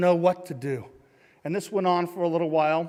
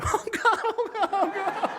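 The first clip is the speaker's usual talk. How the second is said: very high squealed pleading; mock panic. Boxer stands there under punches, high mock-terrified squeal. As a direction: squeal it like a comic coward.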